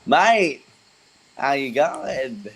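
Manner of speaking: high, loud Aussie 'Mate', then a slow 'how you going'; broad Australian greeting. Retold or imitated. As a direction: lay the Australian accent on thick, calling to a mate. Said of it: "forget slash remember your roots".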